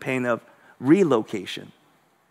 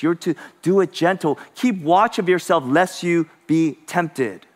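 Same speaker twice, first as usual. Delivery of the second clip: usual speaking voice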